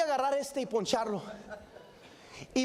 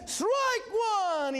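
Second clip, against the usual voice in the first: umpire's strike call; 7 semitones higher than usual. Retold or imitated. Umpire calls strike in game.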